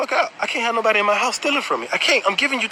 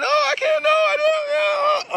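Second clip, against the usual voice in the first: high whiny mock sob, drawn out; mocking whimper; mock crying; slower than usual. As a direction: imitate her fake crying, high and slow. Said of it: "and she's crying".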